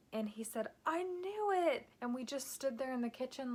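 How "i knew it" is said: excited exclamation. Retold or imitated. Husband hears the good news.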